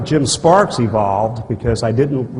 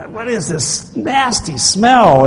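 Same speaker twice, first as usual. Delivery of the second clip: slow, much higher and louder disgusted cry; high, slow revolted outburst; 10 semitones higher, 5 dB louder, much slower than usual. Retold or imitated. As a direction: recoil from the stink, drawing it out. Disgusted at the smell.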